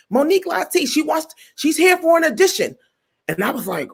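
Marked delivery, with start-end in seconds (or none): 0.1-2.8 high, showy introduction; guy presenting a comedian, high excited; excited announcement. Retold or imitated. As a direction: present her with a flourish, pitched up.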